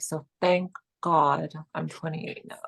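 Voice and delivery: normal talking voice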